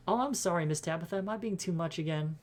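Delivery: sheepish, perky voice; apologetic cleaner. Acted. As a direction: apologise sweetly after being checked for overdoing it. Janie apologises to Tabitha.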